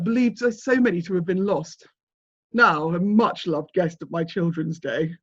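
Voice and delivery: grand, theatrical collector; self-important manor master. Acted. Master showing the detective his ritual objects, grandly self-important. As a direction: present each treasure with proud ceremony.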